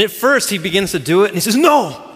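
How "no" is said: sharp, raised coach's "no"; imitated forceful rebuke; stern correction. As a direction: cut in loud and higher, acting a strict teacher. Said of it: "he's hard on him".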